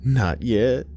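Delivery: a touch of motherly mischief; playful mama. A narrator's reading. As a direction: answer with a gentle playful lilt. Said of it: "Mama smiled playfully".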